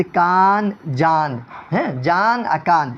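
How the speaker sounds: کان، جان with each final noon fully sounded; clearly sounded final n. Shown as a demonstration. Teacher shows students the clear noon, clearly sounded final n. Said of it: "صاف صاف پڑھا جائے".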